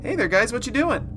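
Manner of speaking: normal talking voice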